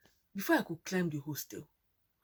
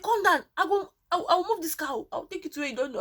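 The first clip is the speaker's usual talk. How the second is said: much higher, louder mock driver; the man calming her; 11 semitones higher, 7 dB louder than usual. Driver telling her to calm down, high and loud. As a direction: play the driver half-shouting reassurance. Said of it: "The man said".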